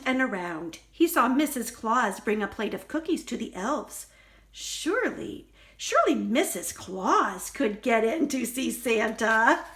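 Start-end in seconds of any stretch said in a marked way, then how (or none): none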